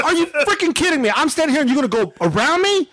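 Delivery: high, indignant disbelief; retold tirade. Customer skipped in line confronting worker, pissed-off tirade. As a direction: pitch it way up, replaying the outrage. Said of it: "he hears my tirade".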